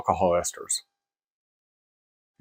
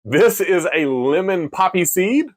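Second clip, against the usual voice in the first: ordinary delivery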